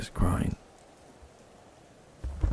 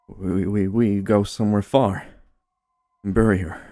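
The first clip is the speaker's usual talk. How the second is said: louder, pitched slightly up, stammering urgency; urgent, distressed wife; 2 semitones higher, 8 dB louder than usual. A narrator's reading. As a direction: push the words out in a panic. Wife proposes burying the child.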